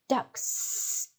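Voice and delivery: ducks whispered, the s hissed out; final s sound. Shown as a demonstration. Teacher modelling the plural ducks, whispered with a hissed s. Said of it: "can you say the word here?".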